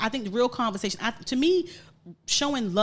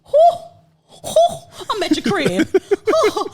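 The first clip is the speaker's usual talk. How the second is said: breathless whooping gasps, much higher and louder; hyperventilating; 12 semitones higher, 12 dB louder, much slower than usual. Real, not acted. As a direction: gasp for air between laughs. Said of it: "Think I'm hyperventilating".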